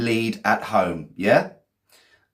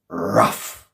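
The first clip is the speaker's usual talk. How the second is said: dog voice. Pretend. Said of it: "And the dog went".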